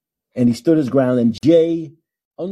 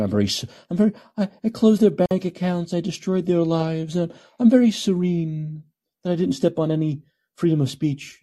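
mock serial killer calm; 7 semitones higher than usual. Host mocks Trudeau's words as a calm serial killer, higher. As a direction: sound eerily serene while confessing. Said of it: "That's the way a serial killer talks".

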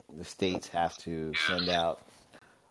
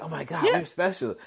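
higher, amazed tone; mock amazement; 10 semitones higher, faster than usual. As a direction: gush like you found treasure. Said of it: "We was like".

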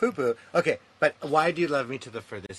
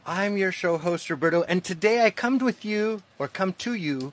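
bright, upbeat podcast-host opening; show host intro; 5 semitones higher, 7 dB louder than usual. Acted. Host welcoming listeners to his show, upbeat announcer voice. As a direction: welcome the audience like a radio host.

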